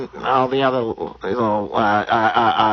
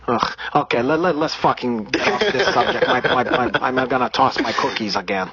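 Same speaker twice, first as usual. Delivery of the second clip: put-on Brazilian accent; 2 semitones higher than usual. Comedian holding a put-on Brazilian accent.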